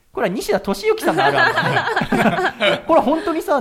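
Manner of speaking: high, loud and slow through laughter; amused laughing delivery. Real, not acted. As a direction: laugh the punchline out. Host laughs at mix-up joke.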